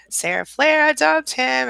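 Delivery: high, drawn-out cheer; stretched celebratory repeat. Real, not acted. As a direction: savour the news slowly.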